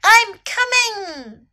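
high, drawn-out call; crying out. A narrator's reading. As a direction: call out slowly and high, as to someone far away. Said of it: "Snake cried".

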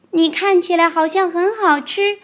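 高高的童声，"看"字上扬，天真地复述; 小孩天真复述"你看起来好像很好吃"; 宝宝学霸王龙的话. Retold or imitated. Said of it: "你说". (童声天真地复述这句话)

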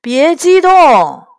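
leisurely, high, projected character voice; unhurried. A narrator's reading. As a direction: take your time, stretching it out. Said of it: "第一个声音不紧不慢地说".

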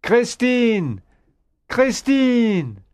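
high, urgent calling; shouted name. A narrator's reading. Raoul calls to captive Christine, urgent shout. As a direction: call out for her through a wall. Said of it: "Raoul shouted".

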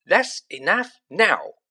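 stern warning. A narrator's reading. Mrs Weasley silencing the twins, stern and slow. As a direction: put a stop to it like a mother. Mother stopping the twins' teasing.

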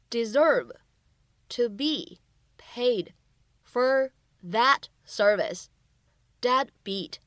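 very slow, word by word; slow teaching read of the scene line. Shown as a demonstration. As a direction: spell out each word slowly for learners. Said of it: "需要注意哪些发音技巧".

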